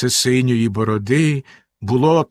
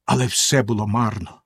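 ordinary delivery, nothing marked

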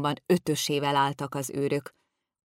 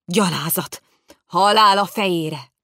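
bellowing; 3 semitones higher, 8 dB louder than usual. A narrator's reading. Lord in the council room, bellowing in fury. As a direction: roar it so it booms down the corridor. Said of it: "végigbömbölte az egész folyosót".

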